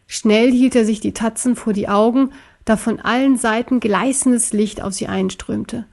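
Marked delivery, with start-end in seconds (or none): none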